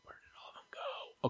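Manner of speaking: whispered to self; breathy muttered search. Real, not acted. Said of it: "now I just lost it".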